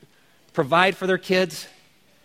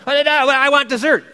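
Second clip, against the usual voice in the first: put-on whiny child; 8 semitones higher, 5 dB louder than usual. Child whining for dessert.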